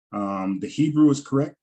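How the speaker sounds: ordinary talk, nothing marked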